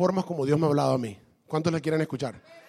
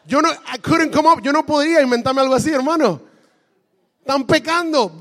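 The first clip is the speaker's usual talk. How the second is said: excited; 7 semitones higher, 6 dB louder than usual. Real, not acted. Excited over revelation.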